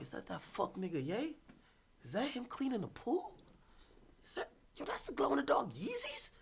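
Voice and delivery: hushed, creeping whisper from a car. Retold or imitated. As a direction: peer out the window and whisper like a stalker. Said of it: "driving around like".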